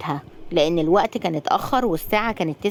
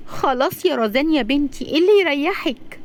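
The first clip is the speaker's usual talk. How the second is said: upset pleading; 7 semitones higher than usual. Acted. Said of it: "صفاء بزعل".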